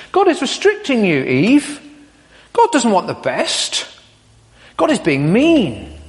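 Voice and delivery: a hint of a coaxing snake.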